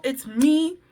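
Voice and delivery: ordinary talk, nothing marked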